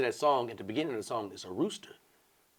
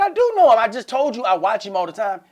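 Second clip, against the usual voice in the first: higher, louder protest; indignant; loud insisting; 12 semitones higher, 11 dB louder than usual. Retold or imitated. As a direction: defend yourself with a raised voice. Insisting to Jimmy's guy.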